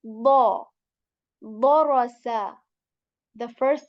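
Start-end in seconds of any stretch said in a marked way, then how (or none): 0.0-0.7 "bo" heavy and slow, full mouth. Shown as a demonstration. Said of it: "The starting sound is like bo". 1.4-2.6 "Bo, ro, sa" with a loud full-mouth "Bo"; heavy bo syllables. Shown as a demonstration. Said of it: "The starting sound is like bo".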